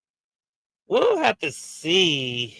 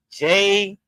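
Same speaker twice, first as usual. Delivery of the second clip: hype announce; 7 semitones higher, 6 dB louder than usual. Real, not acted. Host hyping Jey Uso's win to viewers, an excited high loud call.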